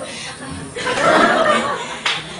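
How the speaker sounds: high-pitched burst of laughter; laughing. Real, not acted. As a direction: break up laughing at not knowing. Laughing after admitting not knowing.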